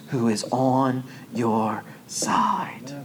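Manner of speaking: slow whispered promise; whispered assurance. Acted. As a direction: slowly whisper it to comfort them.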